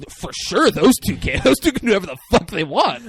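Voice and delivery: high, loud and fast, cheering; laughing, high-pitched cheer; excited. Pretend. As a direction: hype them up like a fan. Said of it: "I'd be like".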